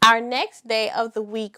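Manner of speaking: ordinary delivery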